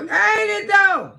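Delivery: loud high teasing shout; 1 loud laughing shout. Real, not acted. Laughing at the snoring clip, loud high shout.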